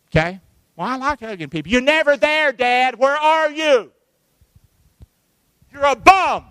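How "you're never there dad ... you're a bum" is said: loud, high outburst at an absent dad; acted angry accusation. Child confronting a dad who is never around, angry shout. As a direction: hurl it out as a hurt accusation.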